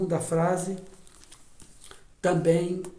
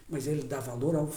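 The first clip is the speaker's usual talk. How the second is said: usual speaking voice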